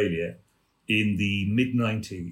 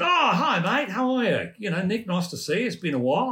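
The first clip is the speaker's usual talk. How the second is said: lightly voiced chummy Australian star, much higher; a hint of Hugh's cheery high greeting; Hugh Jackman; 10 semitones higher than usual. Hugh greeting old colleague Nick at Broadway opening, much higher cheery mimic. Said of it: "and he went".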